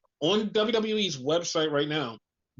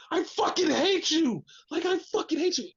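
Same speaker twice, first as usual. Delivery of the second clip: furious yell; 11 semitones higher than usual. Retold or imitated. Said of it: "She's like".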